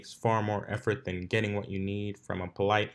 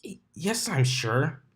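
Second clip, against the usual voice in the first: flustered; 6 semitones higher than usual. Acted. Dash flustered denial.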